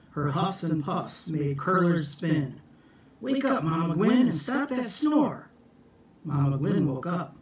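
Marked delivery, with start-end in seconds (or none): 3.2-5.5 higher wake-up call. Acted. Jack calling to snoring Mama Gwen, high call. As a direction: rouse her with a lifted, higher call.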